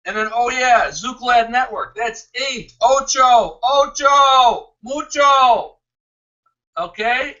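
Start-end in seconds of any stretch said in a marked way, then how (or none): 2.8-5.8 raised, drawn-out Spanish hollers; playful calling out. Pretend. Host calling out for Spanish-speaking friends, playful raised hollers. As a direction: call it out high to the crowd.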